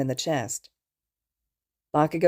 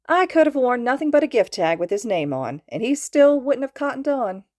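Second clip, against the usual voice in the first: higher, louder teasing call; gleeful holler; 7 semitones higher, 5 dB louder than usual. A narrator's reading. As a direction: holler it with delight. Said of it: "she hollered gleefully".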